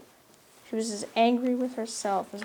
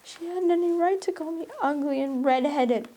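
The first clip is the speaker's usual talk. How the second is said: put-on higher, defiant child's voice; defiant retort; 7 semitones higher, 3 dB louder than usual. A narrator's reading. Anne talking back to Marilla, defiant. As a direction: toss the words back defiantly. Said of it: "retorted Anne, evasive and defiant".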